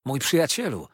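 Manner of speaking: delighted, emphatic address; glad exclamation. A narrator's reading. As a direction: call out with affection. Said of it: "wołał Treadles".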